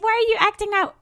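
high-pitched mock complaint; mocking critics. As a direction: imitate whiny critics in a high voice.

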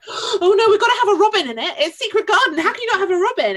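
high, loud, frantic imitation; mock panic. Podcaster imitates film-makers who forgot the robin, mock panic. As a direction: blurt it out loudly in comic alarm.

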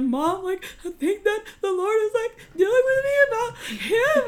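very high, loud tearful wail; hammed-up crying voice; mock crying. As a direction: imitate sobbing out news to mom. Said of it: "you know, like, crying".

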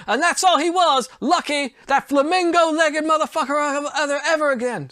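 high, brash champ-style taunt; ad-libbed boxer trash talk. Retold or imitated. Ali voice ad-libbing insults at Wilder, high brash taunt. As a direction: keep riffing in the boxer voice. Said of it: "that's me ad-libbing".

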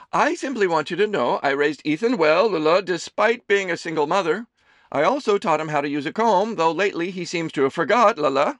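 high, loud doting mother voice; a fussing mother teasing her son; Amelie's voice. A narrator's reading. Mother serving her son lunch, high and fussing.

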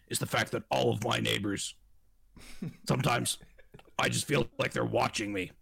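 gruff talk-show host voice, fast in bursts; Alex Jones impression. Alex Jones voice, ranting about paid neighbors. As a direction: rant like a conspiracy radio host. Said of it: "That's an incredible impersonation".

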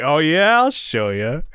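acted-out defiance, loud rising "oh yeah"; defiant comeback. Acting out reply to a dare.

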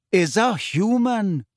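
unhurried, plain pronouncement; simply stated. A narrator's reading. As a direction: state it simply, drawing the words out. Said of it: "said Firenze simply".

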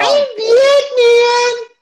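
very high, slow, wailing cry; a hammed-up crying Batman. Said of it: "a deep growly voice".

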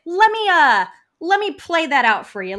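loud, high mock-helpful offer; indignant retort. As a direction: push back loudly at the boss. Acted retort to her boss.

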